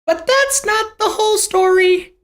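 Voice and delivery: very high-pitched, put-on squeal; exaggerated high cartoonish protest; high mock voice. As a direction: sing it out far above normal pitch. Mock voice after acted wailing kid.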